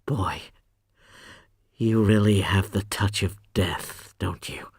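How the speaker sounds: soft, sinking to a whisper; softly. Acted. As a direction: hush it with dull wonder. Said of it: "and says softly".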